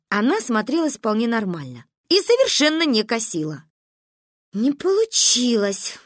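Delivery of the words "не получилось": slow, drawn-out letdown; disappointed drawl. A narrator's reading. Girl seeing her experiment failed, disappointed. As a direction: stretch it out, let down. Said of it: "разочарованно протянула Каришка".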